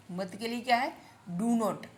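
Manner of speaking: ordinary talk, nothing marked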